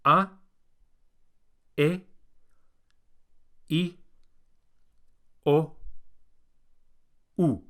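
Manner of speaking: "A, e, i, o, u" each short and pure; pure Spanish vowels. Shown as a demonstration. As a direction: clip each vowel, no glide. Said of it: "Can you repeat after me?".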